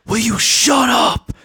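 high, slow fake kid shout; pretend shout. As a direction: shout without really shouting, like a kid playing. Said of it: "playing as a kid and you don't wanna shout".

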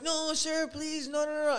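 high-pitched imitation of pleading staff; mock pleading. Staff begging customers not to walk out, mock pleading.

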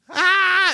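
high, loud shriek of laughter; loud mock howl of laughter; imitated family shriek. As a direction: shriek like the whole family cracking up.

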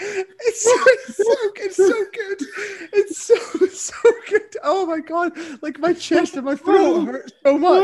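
real tearful laughter, high-pitched words; laughing so hard it hurts; helpless laughing. Real, not acted. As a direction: gasp out praise while cracking up. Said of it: "I'm fucking laughing".

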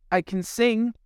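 put-on high, proud girlish reply; hopeful young mermaid. Mermaid answers witch.

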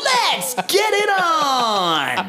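stuttered remix into high hype shout; huge drawn-out high announcer cry; human soundboard. Acted. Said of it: "He's a soundboard".

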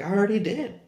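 a hint of a clueless child; lightly voiced innocent answer; innocent kid reply. Naive younger self answering.

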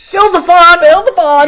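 hammed-up shout of the quote; mock shout. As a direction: shout the line back in mockery.

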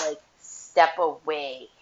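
ordinary talk

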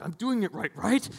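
high, eager mock plea; hammed-up anxious people-pleaser; anxious seeking approval. Pastor mimicking a giver anxious for God's approval, high mock plea.

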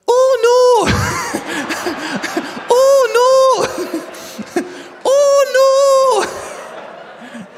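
high, loud Scottish cry of oh no; panicked Scottish accent. Retold or imitated. Stu panicking as the kitchen floods, high loud Scottish wail. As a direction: yelp it like a panicking Scotsman through laughs. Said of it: "Apologies for the Scottish accent".

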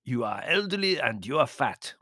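measured, cutting manservant; cold. A narrator's reading. Servant insulting the countess, cold. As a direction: state the insult coldly and deliberately. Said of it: "said Demetrios Mustafa coldly".

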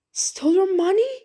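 loud, high, fast indignant cry; accused poacher crying out in loud indignant surprise. Acted. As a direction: fling the question back, outraged and quick. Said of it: "cried Jem".

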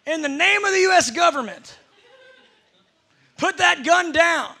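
mock shout, high and loud, slowing at the end; hammed-up command. New deputy shouting at a robber, hammed-up. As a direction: bark it like a proud new deputy.

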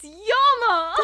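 high, loud, slow gleeful announcement; excited reveal. Real, not acted. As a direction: announce the favourite's return.

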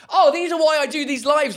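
high and loud with excitement; thrilled by the idea; excited. Real, not acted.

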